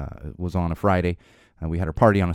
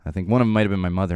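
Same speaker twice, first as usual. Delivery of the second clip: usual speaking voice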